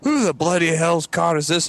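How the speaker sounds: father's exclamation, acted out; imitated irate dad. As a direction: demand it high, like an indignant dad. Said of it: "my dad standing in the driveway".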